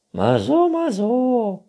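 very high, loud squeak; shouting squeaky raccoon; hard-of-hearing raccoon. A narrator's reading. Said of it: "Du musst lauter reden". (schwerhöriger Waschbär, hoch und laut)

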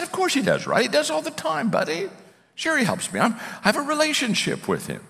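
high-pitched, easy reassurance; friendly assurance. Retold or imitated. Coach telling agnostic colleague God helps him, reassuringly. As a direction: assure a buddy in a light, high voice.

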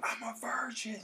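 high, hushed imitation of a yell; held-back mock yell. Retelling a friend's yelling, held-back strained mock yell. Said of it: "he was just yelling".

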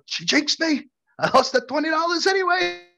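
high-pitched whining imitation; mock indignant complaint. Acting out friend's complaint afterwards.

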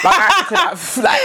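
a real laugh bursting through the boast; laughing shout. Real, not acted. Laughing at her own boast.

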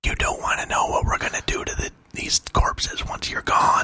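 menacing whisper. Acted. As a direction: whisper a dark threat to the enemy. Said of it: "Has to be a whisper".

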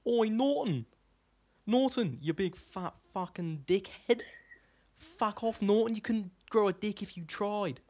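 high-pitched, drawn-out Australian heckle; put-on high Australian voice, stretching the insults; Australian accent imitation. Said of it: "an imitation of this one YouTube".

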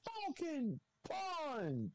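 high, slow drawn-out battle cry; Captain Falcon shout. Retold or imitated. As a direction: stretch it out like a fighting game announcer. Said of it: "it needs to be that exact voice".

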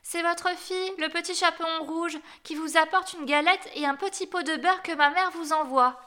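put-on high-pitched innocent child's voice; high, trusting little-girl voice. A narrator's reading. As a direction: announce yourself as granny's little girl. Real child answers at door.

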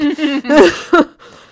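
loud, high burst of laughter. Real, not acted. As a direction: burst out laughing at own story. Laughs after retold retort.

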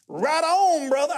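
high, loud congregant's shout; imitating an exuberant outburst in church; hollered affirmation. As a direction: holler approval from the front row. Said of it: "He would do like that".

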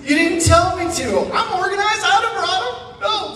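very high, squeaky protest; mock indignant. Guest protesting to college staff in high squeaky indignation. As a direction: whine the excuse in outrage. Indignant reply about pillows.

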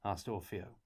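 ordinary delivery, nothing marked

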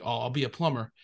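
ordinary delivery

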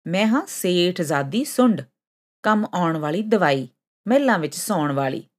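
usual speaking voice

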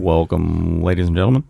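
deep presidential greeting; Barack Obama. Acted. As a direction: drop well down into a statesman's voice. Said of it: "change the tone of your voice".

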